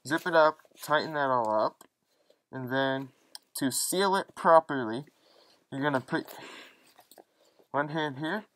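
muffled behind mask filter; through a gas mask. Real, not acted. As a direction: voice inside sealed respirator. Seen 0: Speaker wears full gas mask over face while talking.

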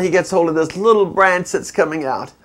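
ordinary talk

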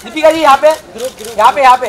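loud, high repeated call. Real, not acted. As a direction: shout to pull her gaze over. Paparazzi calling actress for photo.

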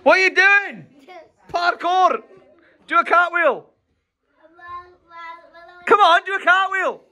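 high excited encouragement; excited cheering. Real, not acted. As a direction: cheer them on loudly. Cheering kid on.

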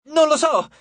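shrieking back. A narrator's reading. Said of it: "strillò lei di rimando".

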